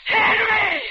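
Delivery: high loud gasp of terror; shocked fearful cry; horrified scream. Acted. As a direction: scream at the sight of him. She sees captor in car.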